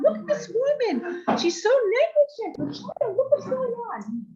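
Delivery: high, drawn-out outraged accusation; yelling. Retold or imitated. Woman denouncing the mother to onlookers, yelling. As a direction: denounce her loudly to the onlookers. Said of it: "She's yelling and yelling".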